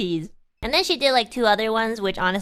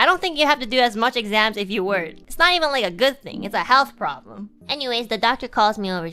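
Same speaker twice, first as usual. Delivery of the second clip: normal talking voice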